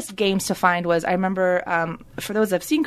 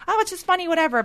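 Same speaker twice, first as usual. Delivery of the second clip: dismissive mock; 10 semitones higher, faster than usual. Mocking dismissive view of comedy.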